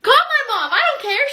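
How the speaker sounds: high, loud defiance; defiant outburst. Acted. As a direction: throw it back at the teacher, loudly. Student defies teacher's threat.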